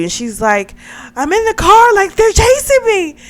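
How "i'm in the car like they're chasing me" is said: high, loud frantic cry; panicked, pitched way up and loud; acted panic. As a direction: imitate someone screaming down the phone mid-chase. Acted panicked phone call during chase.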